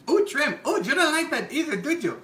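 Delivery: mocking tease. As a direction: rub it in playfully. Mocking the picky friend.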